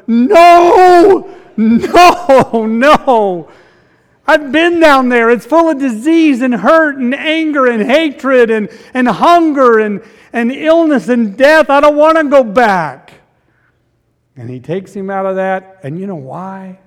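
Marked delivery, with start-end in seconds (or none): 0.1-3.5 loud, high wailed refusal, easing off; acted protest. Lazarus begs Jesus not to send him back, hammed-up protest. 4.3-13.1 loud, high acted protest; pleading Lazarus. Lazarus tells Jesus he won't go back, acted pleading.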